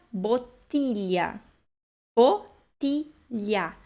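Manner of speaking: "bottiglia" very slow, syllable by syllable with hard ti; hard Italian ti. Shown as a demonstration. Teacher introduces the eleventh word, slow syllabic pronunciation. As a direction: break "bottiglia" into bot, ti, lha. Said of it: "De novo, T I".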